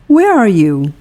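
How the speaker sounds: usual speaking voice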